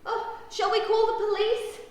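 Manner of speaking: loud, high worried question; alarmed child. A narrator's reading. Ruby fears they are lost, asking in alarm. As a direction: ask in high-pitched alarm. Child panics over missing pair.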